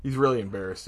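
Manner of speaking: usual speaking voice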